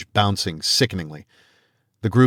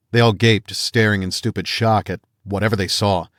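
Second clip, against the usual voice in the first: ordinary delivery, nothing marked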